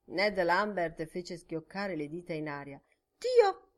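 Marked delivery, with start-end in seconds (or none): 3.2-3.7 sudden high, loud "Dio!"; a sharp startled oath; exclaiming. A narrator's reading. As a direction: blurt it out, struck by a thought. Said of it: "esclamò".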